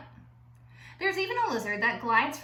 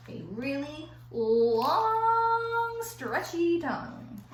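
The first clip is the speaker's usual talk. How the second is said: drawn out; 6 semitones higher, much slower than usual. Acted. Stretching words to show length.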